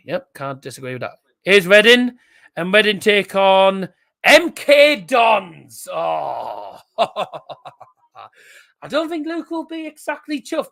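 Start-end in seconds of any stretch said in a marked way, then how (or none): 1.4-5.9 loud, slow call of his own team's draw; excited announcement. Real, not acted. Streamer calling out his club's cup draw, excited and loud. 6.9-10.7 real laugh through the words, high and slow. Real, not acted. Laughing to chat about Luke's disappointment, high and giggly.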